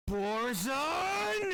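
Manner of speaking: loud high call across the room; yelling a name. Acted. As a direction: shout his name angrily. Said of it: "She's just yelling".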